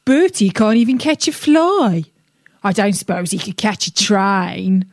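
a lightly voiced grumpy carp sneering; grumpy fish. A narrator's reading. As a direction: scoff like a sour old fish. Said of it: "who is a very grumpy fish".

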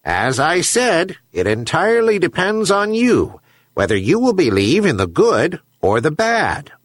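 high-pitched talking seabird voice; herring gull. A narrator's reading. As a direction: answer as a gull, pitched well up. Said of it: "but the gull continued".

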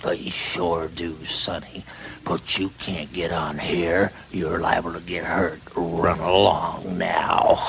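low, slow and soft engine voice; deep unhurried big-engine voice; big old freight engine. Acted. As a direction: talk down kindly to a youngster, slowly. Freight engine talks down to sonny.

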